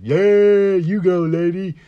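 high, loud, slow character voice; cartoonish Crank Yankers cheer; Special Ed voice. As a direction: call it out high and drawn like the puppet.

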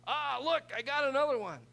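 high, loud mock devil crowing over a prize; hammed-up gleeful devil; gloating devil. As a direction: crow like the devil with a trophy.

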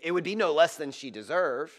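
ordinary delivery

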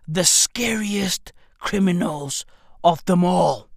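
hushed, slow, mock-ominous whisper; mock spooky whisper. Telling viewers about the criminals in a mock spooky whisper. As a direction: lean in and whisper it like a ghost story.

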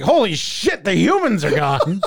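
high-pitched, laughing mock astonishment; mock amazed animals. Animals seeing the goats' empty neighborhood, mock amazement. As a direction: exclaim it high, breaking into a laugh. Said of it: "all the animals are like".

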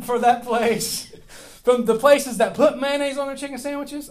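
high, loud laughing through the words. Real, not acted. Pastor catching himself naming a restaurant, laughing. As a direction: laugh it out while correcting yourself.

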